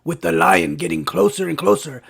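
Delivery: normal talking voice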